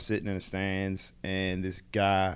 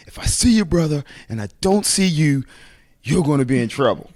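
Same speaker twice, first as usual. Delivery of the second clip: high, loud mock coach threat; coach warning; 7 semitones higher, 8 dB louder than usual. Coach threatening the brothers, loud and high. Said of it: "So my coach was like".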